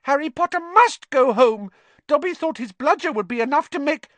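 high, loud, squeaky elf voice, hurried; squeaky elf, insistent and anxious; urgent house elf. Acted. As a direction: blurt it out urgently. Elf voice held.

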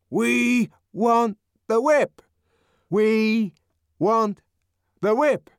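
slow, loud, insistent chant; loud insistent monotone. A narrator's reading. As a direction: drone it out insistently. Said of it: "the same loud, insistent monotone".

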